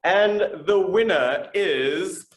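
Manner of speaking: slow, drawn-out winner reveal; raffle caller. Acted. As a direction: stretch the name for suspense.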